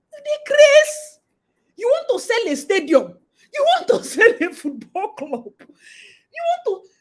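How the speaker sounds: high, loud, laughing disbelief; half laughing, can't believe the nerve; incredulous outburst. Real, not acted. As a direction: squeal in amazed outrage. Incredulous rant about scammers.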